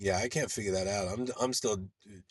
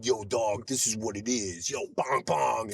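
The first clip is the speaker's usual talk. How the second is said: mock street persona; slower than usual. Recalling an actor's last episode, mock street-tough voice. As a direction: imitate a street-tough act. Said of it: "last episode I saw you going".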